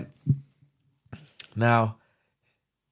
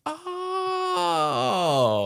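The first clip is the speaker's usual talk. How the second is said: a high, stunned "Oh" in a put-on voice; floored little oh. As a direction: gasp it out, caught off guard by what you read. Reading rival's messages, shocked.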